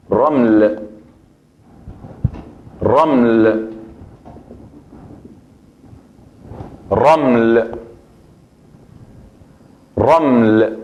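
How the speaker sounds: slow teacher demo of raml with trilled r; Arabic word raml. Shown as a demonstration. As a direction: say raml slowly, r rolled. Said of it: "Esa es como se escribe". (pronunciación árabe de raml, lenta)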